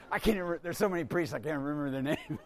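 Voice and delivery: quick words through real laughter; laughing aside. Real, not acted. A priest laughing at himself for forgetting the other priests' names. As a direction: giggle it out, hurried and soft.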